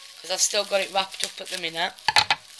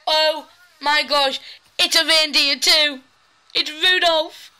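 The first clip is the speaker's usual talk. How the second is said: excited; 5 semitones higher, 5 dB louder than usual. Real, not acted. Excited about the reindeer.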